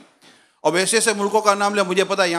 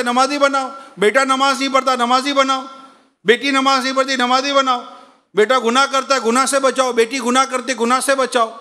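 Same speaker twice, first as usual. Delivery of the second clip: raised, higher preaching voice, driving each repeat; fervent preaching; 6 semitones higher than usual. Acted. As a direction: preach it like a rising refrain. Preaching at full force.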